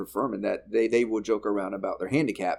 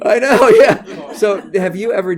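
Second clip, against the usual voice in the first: slow, drawn-out words through real laughter, higher and louder; laughing; 10 semitones higher, 14 dB louder, slower than usual. Real, not acted. Host agreeing with guest, slow and laughing hard. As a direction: still cracking up, barely getting it out. Still laughing after the gaffe story.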